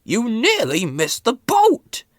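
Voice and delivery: high, loud and slow, very cross; stern boss scolding; cross Fat Controller. A narrator's reading. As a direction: demand an answer from a late engine. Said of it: "he asked".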